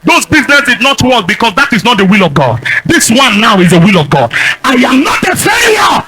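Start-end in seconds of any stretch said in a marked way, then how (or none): 4.6-6.0 high, drawn-out preacher's shout; shouted declaration. Real, not acted. Preacher declaring himself no failure before congregation, defiant shout. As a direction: cry it out slowly as a defiant confession.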